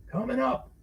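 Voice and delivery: high barman voice; quick barkeep reply; bartender voice. A narrator's reading.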